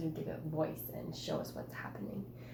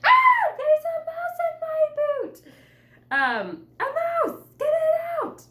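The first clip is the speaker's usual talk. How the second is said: high, loud panicked shriek; screaming in panic; 11 dB louder than usual. Shown as a demonstration. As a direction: shriek it out like a mouse is in your boot. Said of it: "I would be like".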